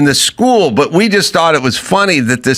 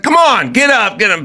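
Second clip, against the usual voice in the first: high, pushing pep-rally plea; student pleading with the auditorium to get up; urging crowd; 7 semitones higher than usual. Retold or imitated. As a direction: beg the crowd to stand. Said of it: "she's like".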